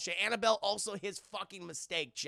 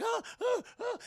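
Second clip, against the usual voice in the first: much higher frantic yelps; mock panic. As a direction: yelp in fake panic. Heroine panicking.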